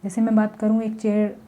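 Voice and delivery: usual speaking voice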